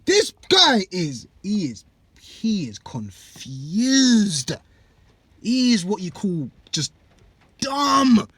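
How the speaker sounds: high, loud, slow stunned "What?"; shocked disbelief. Retold or imitated. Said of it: "you're gonna think".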